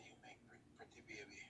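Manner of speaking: breathy hushed compliment; whisper. Real, not acted. Vlogger whispering compliments to the woman, hushed. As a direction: whisper it close and sweet.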